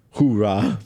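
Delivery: put-on hoorah in a Russian accent. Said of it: "definitely a Russian accent".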